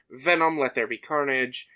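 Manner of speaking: ordinary talk, nothing marked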